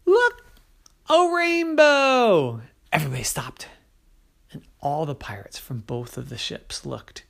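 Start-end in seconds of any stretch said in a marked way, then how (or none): 0.1-2.6 high, loud, drawn-out wonder; feigned excitement. Acted. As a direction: point and sell the trick. Liam trick.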